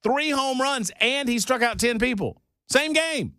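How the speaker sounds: very high, emphatic exclamations; incredulous raving. Real, not acted. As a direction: hammer out the feat in a high, amazed voice. Amazed rant, challenging the others.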